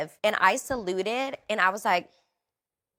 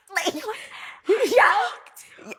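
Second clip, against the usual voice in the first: high, loud burst of laughter; real laughing; 14 semitones higher, 6 dB louder than usual. Real, not acted. Gymnast laughing at her own retold panic, laughing. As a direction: laugh right through the words.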